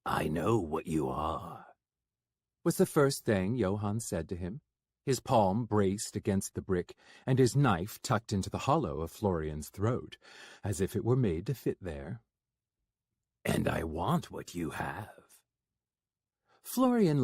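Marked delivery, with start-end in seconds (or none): 0.0-1.7 low, cold threat; Johann, low and menacing. Acted. 13.4-15.4 low, cold threat; Johann, low and menacing. Acted.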